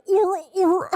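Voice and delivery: high, loud silly "or, or"; playful high yelp; mock "or, or". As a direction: blurt it out in a goofy high voice. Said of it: "don't clip the part where I go".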